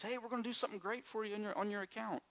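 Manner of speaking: high, quick salesman voice; chirpy advert pitch, sped up; mock cheery bank offer. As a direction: pitch it like an eager bank rep.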